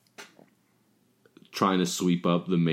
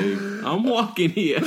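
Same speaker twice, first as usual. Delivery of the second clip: slow, higher, laughing I'm walking here; New York tough guy; 11 semitones higher, much slower than usual. Pretend. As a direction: bark it like a cranky New Yorker. Movie quote impression.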